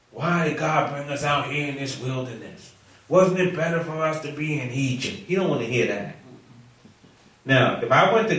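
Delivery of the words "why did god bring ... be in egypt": lightly voiced grumbling; murmuring. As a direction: grumble it among yourselves, a touch put-on. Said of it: "when they're murmuring".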